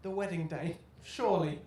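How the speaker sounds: ordinary delivery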